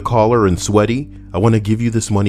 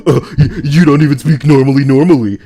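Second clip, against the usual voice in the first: higher, louder teasing jab; mocking inner voice; 6 semitones higher, 7 dB louder than usual. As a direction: taunt yourself a bit higher and louder. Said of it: "I was like".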